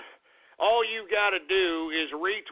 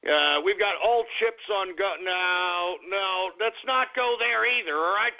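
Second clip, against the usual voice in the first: ordinary talk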